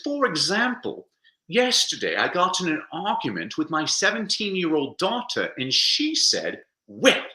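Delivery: put-on British accent; Joe Walker. British film editor quoting his daughter's insult, British accent. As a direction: play a British winner telling an anecdote in his accent. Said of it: "I'm gonna do my British accent".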